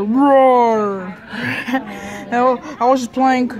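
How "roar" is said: a loud, growling pretend-monster roar. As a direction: roar to play the scary monster. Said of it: "I'm the negative monster".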